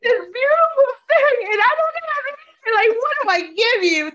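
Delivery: high, loud and crying, words breaking; tearful outburst. Acted. Girlfriend overwhelmed by a locket she cannot repay, crying loudly. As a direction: blurt it out through tears of guilt. Tearful guilt over the gift.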